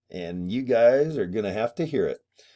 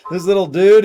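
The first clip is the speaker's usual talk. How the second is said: excited gush; 7 semitones higher, 9 dB louder than usual. Real, not acted. Gushing over the doll.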